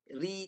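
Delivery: "Ri" with the vowel held a little long; lengthened ri. Shown as a demonstration. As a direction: stretch "Ri" slightly for the learner. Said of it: "tarik sikit".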